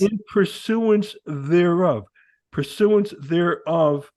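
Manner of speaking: "pursuance thereof" stretched out slowly, word by word; emphatic rereading. Shown as a demonstration. Explaining a constitutional phrase to a co-host, "pursuance thereof" very slow and emphatic. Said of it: "So let me read it again".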